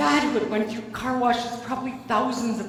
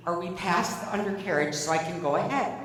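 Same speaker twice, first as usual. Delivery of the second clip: ordinary talk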